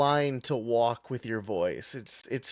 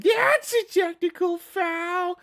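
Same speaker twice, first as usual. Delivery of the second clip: put-on very high, slow, strained old man; cartoon Whitey squeak; 3 dB louder, slower than usual. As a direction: squeeze it up high like an old coot. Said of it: "really high-pitched, the Whitey one".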